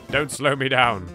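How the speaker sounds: normal talking voice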